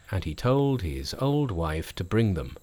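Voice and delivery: usual speaking voice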